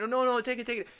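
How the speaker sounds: insisting seller. Retold or imitated. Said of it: "And I'm like".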